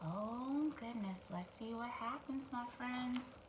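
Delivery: usual speaking voice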